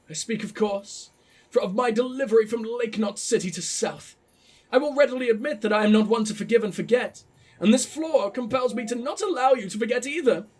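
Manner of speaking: lofty, posh formal delivery. Acted. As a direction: read it out in a grand posh accent. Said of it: "I gotta do the posh voice".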